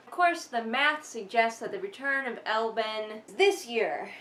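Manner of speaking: ordinary talk, nothing marked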